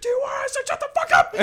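very high, very loud mock yell; hammed-up scream, near laughter; drill instructor screaming. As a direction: imitate an instructor losing it. Drill instructor yells at recruit.

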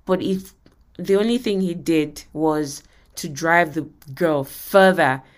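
ordinary delivery

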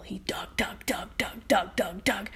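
soft unvoiced dug dug; hushed storyteller sound effect; whispered digging. A narrator's reading.

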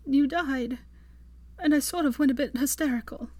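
put-on tearful young woman; Hermione, upset. A narrator's reading. Hermione confesses.

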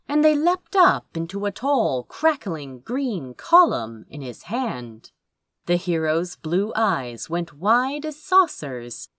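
ordinary delivery, nothing marked

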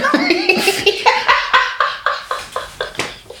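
high squealing laugh; cartoon laugh. Real, not acted. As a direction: burst into a shrill cartoonish laugh. Laughing at partner's antics.

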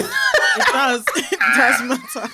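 insisting while laughing; laughing insistence. Real, not acted. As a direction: say it through laughter.

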